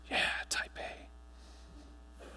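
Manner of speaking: breathy whispered aside, almost no voice; playful whisper. Acted. Whispering a joke aside to the room of dispatchers, playful whisper. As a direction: let it slip out as a conspiratorial whisper.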